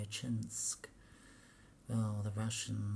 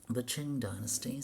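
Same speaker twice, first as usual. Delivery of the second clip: ordinary talk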